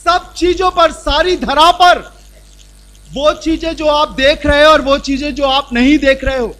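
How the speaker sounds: loud, high, ringing sermon; forceful preaching. Acted. Preacher tells the congregation what God made them, loud and fervent. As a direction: proclaim it triumphantly.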